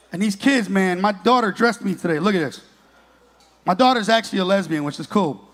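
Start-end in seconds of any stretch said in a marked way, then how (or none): none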